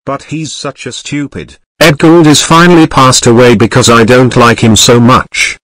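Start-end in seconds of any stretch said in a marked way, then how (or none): 1.8-5.6 a loud gloating announcement. Acted. Kid gloating to his viewers, loud announcement. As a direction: announce it loudly. Gloating about creator's death.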